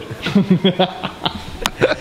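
breathy burst of laughter; hearty laugh. Real, not acted. Friends joking about covid assault, bursting out laughing. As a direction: crack up at your own joke.

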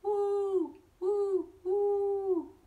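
high hooting owl calls. Acted. Stranger owl calling out to Bob in the dark. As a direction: hoot like an owl in the night. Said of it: "the stranger called out".

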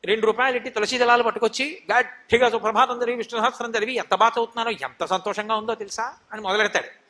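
ordinary talk

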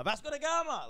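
high, loud quiz-answer shout; yelling answers. Retold or imitated. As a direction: blurt it out like an overexcited viewer. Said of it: "not yell the answers out there".

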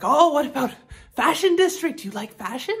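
high-pitched, lightly imitated Thai accent; mock Thai accent. Local man pitching the fashion district, light mock Thai accent. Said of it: "go into a bit of an accent".

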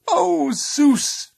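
high, loud exasperated protest; interrupting. A narrator's reading. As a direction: cut in with a loud, high wail. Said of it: "she interrupted him".

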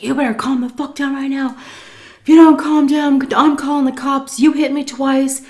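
high, loud angry warning; retold furious threat. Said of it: "he was going off on her".

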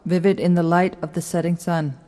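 ordinary talk, nothing marked

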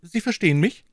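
a lightly voiced king, insistent; the king savouring her unease; pressing king. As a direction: lean on verstehen.

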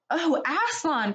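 high cry of awe and relief; joyful cry. A narrator's reading. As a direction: call out to him, amazed. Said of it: "cried both the children".

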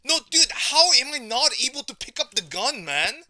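enraged gamer shout; furious outburst. Real, not acted. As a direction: yell it in outrage. Raging about not picking up.

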